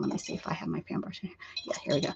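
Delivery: fast, soft unvoiced muttering; rummaging and murmuring aloud; half-whispered to herself. Real, not acted. Muttering to herself while searching.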